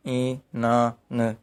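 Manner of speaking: Inan drawn out slowly, syllable by syllable; slow teaching demo of Inan; slow syllable count. Shown as a demonstration. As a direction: stretch Inan out so each syllable is heard. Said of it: "यानी तीन syllable हो गए".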